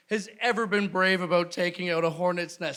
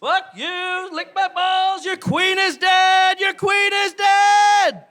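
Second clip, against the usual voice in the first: high, slow victory shouting; triumphant yelling; 10 semitones higher, 5 dB louder, slower than usual. Pretend. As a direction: gloat at the nest at full voice. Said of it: "you're all cocky".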